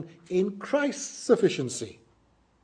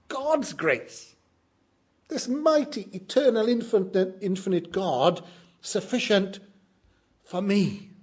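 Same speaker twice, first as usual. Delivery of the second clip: joyful wonder; 6 semitones higher than usual. Retold or imitated. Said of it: "burst out laughing".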